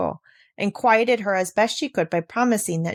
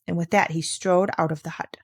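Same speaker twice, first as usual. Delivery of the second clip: plain reading voice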